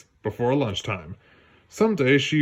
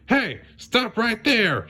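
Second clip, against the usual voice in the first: high, slow shout after the thief; Judy calling; 10 semitones higher, slower than usual. Acted. Judy shouting at Duke while racing, high slow voice. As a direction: call him to stop, drawn out. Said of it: "she said".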